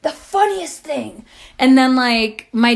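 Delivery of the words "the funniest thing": high, breath-short amusement; imitating someone in hysterics; retold hilarity. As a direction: say it high, as if cracking up. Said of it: "laughed his ass off".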